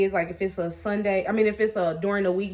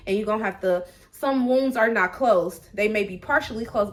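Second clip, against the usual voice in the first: ordinary talk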